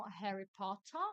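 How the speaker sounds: Potter with a clear, crisp double T; Harry Potter, T's fully sounded; British T pronounced. Shown as a demonstration. Teacher contrasting two British ways to say Potter. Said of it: "ou você vai falar com muito sotaque".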